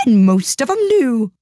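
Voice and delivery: loud, high, very drawn-out character voice; Duchess. A narrator's reading. Duchess answers Alice on grinning cats, loud high character voice. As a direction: declare it slowly, pitched high and loud.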